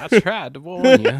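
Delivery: high, loud Southern belle; Southern belle accent. Acted. Co-host joins in, Southern belle accent. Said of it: "doing a Southern Belle accent as well".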